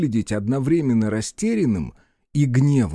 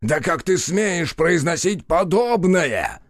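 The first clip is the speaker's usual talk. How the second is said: high, slow, indignant booming god; outraged divine fury; 6 semitones higher, slower than usual. Acted.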